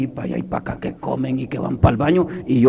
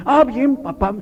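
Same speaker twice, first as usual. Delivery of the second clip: put-on high-pitched child answering dad; high, quick reply to a parent; son's voice; 12 semitones higher, 4 dB louder, slower than usual. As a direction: pipe up as a young son. Voices son answering his father.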